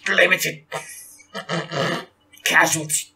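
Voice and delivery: high, halting words through static; crackly broken radio message; radio breaking up. Acted. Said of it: "excellent breaking up radio impression".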